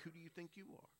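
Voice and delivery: hushed, needling inner tempter; Satan's inner voice. Acted. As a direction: drop to a soft, undermining aside. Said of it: "Satan will begin to work on you".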